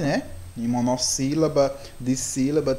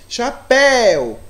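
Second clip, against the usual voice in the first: "chapéu" with "péu" drawn out, louder and higher; stress on last syllable; 7 semitones higher, 7 dB louder, much slower than usual. Shown as a demonstration. Teacher showing pupils the strongest syllable, "péu" stressed hard in "chapéu". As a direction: punch and stretch the final "péu" of "chapéu". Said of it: "ele sobe, a intensidade dele é mais alta".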